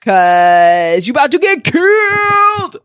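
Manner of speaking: slow build to a high holler; hammed-up, stretched-out warning cry; gleeful threat. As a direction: draw it out and climb into a playful shout.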